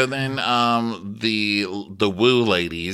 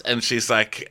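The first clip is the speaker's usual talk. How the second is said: normal talking voice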